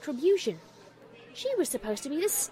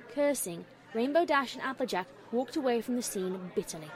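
ordinary delivery, nothing marked

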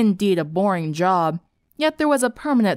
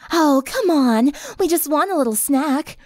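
coaxing; 7 semitones higher than usual. Acted. Vampire begging fleeing girls for a snack, coaxing. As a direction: wheedle them in a raised voice.

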